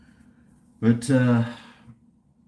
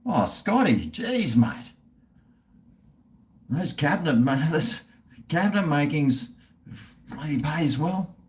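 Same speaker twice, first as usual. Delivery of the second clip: mock Aussie bloke; 4 semitones higher than usual.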